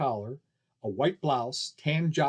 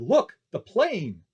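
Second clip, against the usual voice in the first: louder, slow alarmed call; shouted warning; 5 semitones higher, 4 dB louder, much slower than usual. Acted. Man warning the crowd of the falling plane, shout. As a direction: shout to make them look. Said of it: "pointed to the left and shouted".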